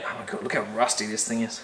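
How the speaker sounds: usual speaking voice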